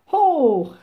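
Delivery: loud, high cheering "Hoch"; crowd cheer. A narrator's reading. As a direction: shout "Hoch" like a festive crowd. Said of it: "riefen alle Leute".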